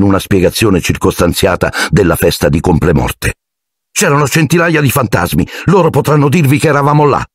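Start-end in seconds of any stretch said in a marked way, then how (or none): 3.9-7.2 higher, fast pleading; hurried higher schoolboy voice; eager defence. Acted. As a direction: rush to prove the alibi. Harry defending himself quickly.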